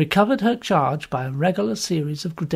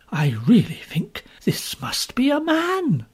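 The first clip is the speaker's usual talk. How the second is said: higher, breathless whisper to himself; breathless reflection; 7 semitones higher, slower than usual. A narrator's reading. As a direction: gasp the thought under his breath. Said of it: "Mister Lorry's breathless reflection".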